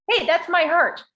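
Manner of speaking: high, loud imitation of an indignant daughter; imitated indignant daughter. Daughter claiming her heart back from mom, indignant, high and loud. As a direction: pipe up sharply as the protesting daughter.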